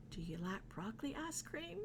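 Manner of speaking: soft, quick rising question; hushed trick question. Shown as a demonstration. Setting up the silly food combination, hushed question. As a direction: drop the volume and ask it quickly.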